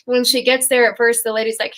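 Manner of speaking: ordinary delivery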